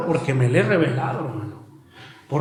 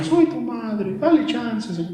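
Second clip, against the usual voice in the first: mock interceding Mary; 5 semitones higher, slower than usual. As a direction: plead sweetly as a mother interceding. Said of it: "no va a estar María".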